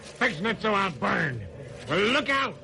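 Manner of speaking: snarling gangster threat at the judge; defiant threat. Acted. Convicted killer threatens the judge, snarling. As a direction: spit the threat at the bench.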